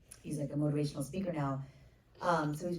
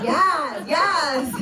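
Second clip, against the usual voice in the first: much higher and louder, laughing yes; ecstatic yes; 12 semitones higher, 11 dB louder than usual. Retold or imitated. Cheering the writer's bold Oedipus idea, laughing. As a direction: shout yes through laughter.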